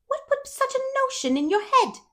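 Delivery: high, gasping disbelief. A narrator's reading. Rachel hearing Marilla's news, gasping in shock. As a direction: gasp it out, scandalised. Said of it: "gasped Rachel".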